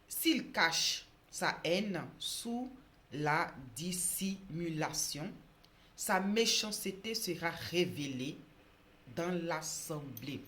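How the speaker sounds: loud, slow, solemn proclamation; Bible reading. Acted. Preacher reading a proverb to viewers, loud solemn reading. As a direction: read scripture out with weight, pausing between phrases. Reads Bible verse aloud.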